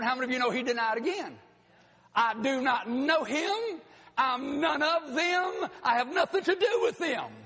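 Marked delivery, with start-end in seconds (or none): 2.1-7.3 emphatic retold denial. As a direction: draw out each word, rising in pitch toward the end. Peter's second denial, acted out.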